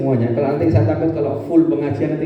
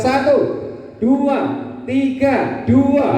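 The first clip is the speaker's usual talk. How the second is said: calling out counts; 11 semitones higher, much slower than usual. Shown as a demonstration. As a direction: drill the audience with sharp calls. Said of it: "Agak cepat".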